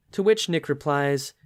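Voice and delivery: normal talking voice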